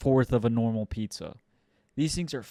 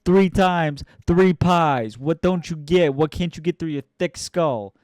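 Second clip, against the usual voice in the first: loud, raised, impatient yelling at a customer; fed-up New York pizza guy yelling at an old lady; mock angry pizza guy; 4 semitones higher, 8 dB louder than usual. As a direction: bark the prices at her like a fed-up pizza man.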